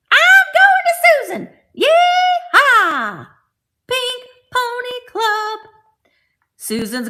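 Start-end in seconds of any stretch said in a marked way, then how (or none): 0.1-5.6 very high, loud shouted cowgirl cheer; hollering like a happy Texan doll; high excited yeehaw shout. Pretend. Voicing the Texan doll leaving.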